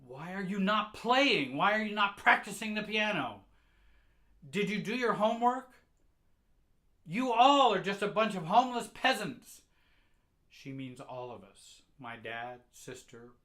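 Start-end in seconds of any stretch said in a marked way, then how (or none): 0.1-9.6 high, loud scolding; furious mother. Retold or imitated. Mother yelling at her child about piano and homework, loud angry scolding. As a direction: rip into them for not practicing.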